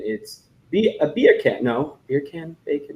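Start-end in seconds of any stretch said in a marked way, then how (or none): 0.7-1.6 Jamaican accent. Shown as a demonstration. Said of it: "If you wanna say the word bacon in Jamaican". 1.6-2.6 "beer can" softly, as a Jamaican "bacon"; a shy stab at Jamaican "bacon"; mock Jamaican accent. Shown as a demonstration. S2 clumsily attempting the Jamaican "bacon" joke, quiet mock Jamaican accent. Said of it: "If you wanna say the word bacon in Jamaican".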